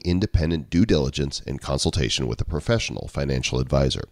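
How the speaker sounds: steady formal disclaimer read. Acted. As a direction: read the fine print evenly. Reads legal disclaimer.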